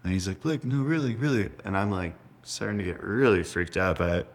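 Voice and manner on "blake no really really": imitating brother, high and insistent; brother's old voice. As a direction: plead in a higher kid brother voice. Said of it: "old voice, his old voice".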